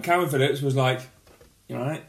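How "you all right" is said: soft, understated mock greeting; offhand greeting. Calvin Phillips imitated greeting someone, offhand and quiet. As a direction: toss it off quietly, barely bothered. Acting cool stepdad encounter.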